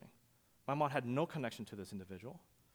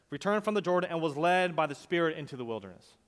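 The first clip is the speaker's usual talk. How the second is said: loud, higher, ringing sermon voice; full-force preaching; 6 semitones higher, 8 dB louder than usual. Acted. Pastor preaching to his congregation, loud and rising. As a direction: preach it out to the back row.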